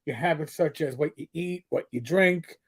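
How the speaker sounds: usual speaking voice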